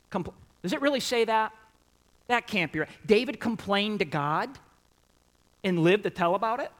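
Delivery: quick, high disbelief; mock shock. Preacher feigning shock that David complained to God. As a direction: act stunned at the verse. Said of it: "mock disbelief at the verse".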